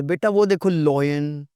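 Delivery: लॉयन drawn out with an "oy"; imitated mispronunciation. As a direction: mimic a mother saying लॉयन as "loyen". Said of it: "It's not lion यार". (माँ की नकल, लॉयन में "ऑय")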